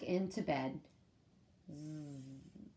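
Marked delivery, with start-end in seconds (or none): 1.7-2.7 quiet sleepy zzz; soft snore sound. A narrator's reading. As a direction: breathe out a gentle snore. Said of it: "went Lula".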